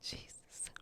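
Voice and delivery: breathy whisper, overcome; whispered Jesus. Real, not acted. Moved by the episode, she whispers to herself. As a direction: barely breathe the word.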